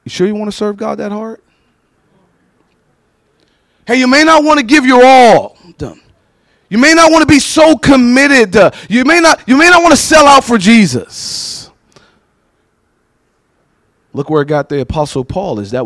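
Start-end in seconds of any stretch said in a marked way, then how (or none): 0.0-11.7 lightly voiced tempter, rising insistent; devil's whisper. The devil tempting believers, lightly voiced coaxing. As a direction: coax them away from God. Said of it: "the devil starts saying things like".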